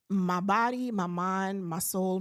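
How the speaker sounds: ordinary delivery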